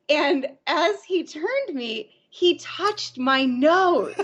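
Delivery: slow, laughing through the words; can't stop giggling as she tells it; slowed-down giggly retelling. Real, not acted. Laughing at the nose touch.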